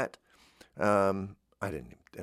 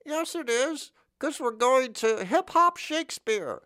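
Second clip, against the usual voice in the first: high, slow hammed-up rapper-actor voice; mock hip-hop Shakespeare; slower than usual. Comic mocking a hip-hop Shakespeare pitch, high and hammed-up.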